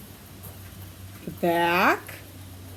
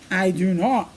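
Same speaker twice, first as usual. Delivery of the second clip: lower, gruff refusal; grumpy dog voice; 5 semitones lower, 3 dB quieter than usual. Acted. Reading to a child, the other dog refuses in a low gruff voice. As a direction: turn her down low and curt.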